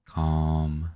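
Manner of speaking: ordinary delivery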